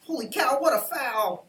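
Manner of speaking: high, loud cry at the play; excited outburst. Real, not acted. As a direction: yell it like a fan at the screen. Reacting to the game.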